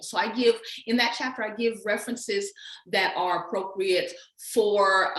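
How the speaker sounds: ordinary talk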